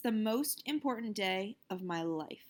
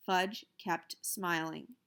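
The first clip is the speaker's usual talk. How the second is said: ordinary delivery, nothing marked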